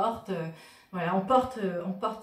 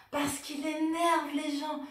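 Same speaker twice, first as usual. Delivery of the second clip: gleeful; 7 semitones higher, slower than usual. Real, not acted. Gleeful reason for favourite t-shirt.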